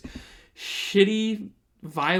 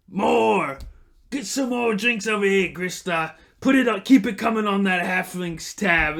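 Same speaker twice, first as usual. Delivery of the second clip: shouting for more drinks; rowdy yelling thug; 4 semitones higher, 5 dB louder than usual. Acted. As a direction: bellow across the tavern, high and loud. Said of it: "they start yelling to Grista".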